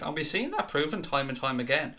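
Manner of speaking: usual speaking voice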